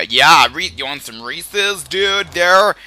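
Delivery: loud put-on American accent. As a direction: imitate an American dude offering candy. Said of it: "that's my American accent".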